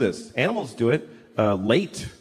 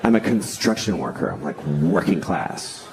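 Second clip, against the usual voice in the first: low, self-satisfied tough guy; Matt Damon; 12 semitones lower than usual. Acted. Actor writing himself as working-class hero, smug. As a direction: drop low and brag, savouring it. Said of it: "he basically sat down and he's like".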